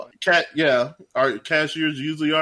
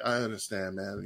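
soft, low and soothing; calm soothing; 6 semitones lower, 11 dB quieter than usual. Retold or imitated. Worker calming an angry customer, soft and soothing. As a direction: talk him down gently. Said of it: "I was like".

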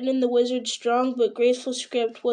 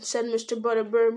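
ordinary delivery, nothing marked

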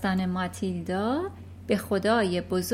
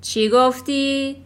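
slow, louder, sharp demand; sharp question; 4 semitones higher, 8 dB louder, slower than usual. Acted. Headmistress demanding what the girl said, sharply. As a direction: snap the question at her. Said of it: "به تندی پرسید".

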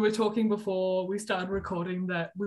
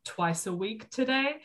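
ordinary delivery

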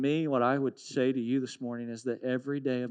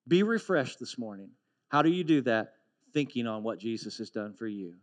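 ordinary delivery

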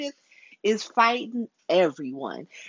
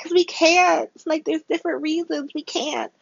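mock whiny protest; 10 semitones higher than usual. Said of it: "And they're like".

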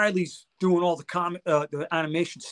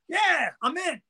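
eager yes; 9 semitones higher, slower than usual. Retold or imitated. Kane eagerly agreeing to join.

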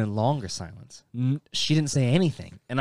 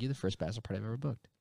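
flat hostess; 3 semitones lower, 14 dB quieter, much faster than usual. Retold or imitated. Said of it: "She's like".

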